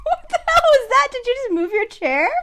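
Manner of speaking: high, loud, incredulous outburst; startled. Real, not acted. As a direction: demand to know what just happened, high and loud. Startled by co-host's sudden shout.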